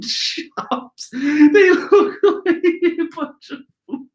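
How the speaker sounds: high, slow words squeezed out through laughter; cracking up while reading the panel; laughing. Real, not acted. Laughing at the kids in the flashback panel.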